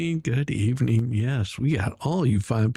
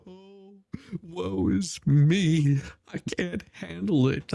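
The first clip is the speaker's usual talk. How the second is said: mock self-pity; 5 semitones higher, slower than usual. Mocking the no-shows' self-pity.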